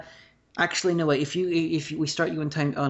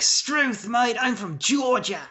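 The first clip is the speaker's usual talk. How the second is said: higher, slower hammed-up Australian accent; mock Australian accent; 9 semitones higher, much slower than usual. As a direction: camp it up like a thick Australian who swears he's from Georgia. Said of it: "we were all like".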